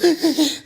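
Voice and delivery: high, jerky mock sobs; demonstrated convulsive weeping, high-pitched; imitated sobbing gasps. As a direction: act out hiccuping crying breaths. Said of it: "¿Sabes que lloras tan así?".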